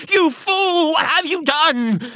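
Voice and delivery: high, loud wail of wild panic; frantic woman at her wits' end; hysterical shout. A narrator's reading. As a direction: shriek the accusation, dragging out the last words. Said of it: "a note of wild hysteria in her voice".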